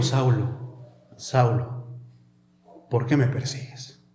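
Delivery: low, soft, measured questioning; gently questioning. Shown as a demonstration. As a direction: question him quietly, leaving space between phrases. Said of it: "le estaba cuestionando". (cuestionando en voz baja y grave)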